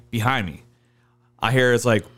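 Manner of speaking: ordinary delivery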